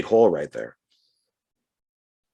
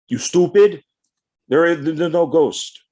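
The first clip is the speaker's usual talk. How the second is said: higher, louder, hammed-up Russian accent; a brother scoffing at the ghost legend in a bad Russian accent; 6 semitones higher, 4 dB louder, slower than usual. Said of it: "bad Russian accent".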